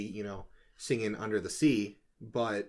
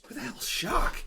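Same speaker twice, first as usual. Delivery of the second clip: whispered. Pretend. Half-joking repeat of the question.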